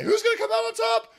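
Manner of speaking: high, excited teaser; hyped-up question. Real, not acted. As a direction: build the suspense high. Hype question at intro.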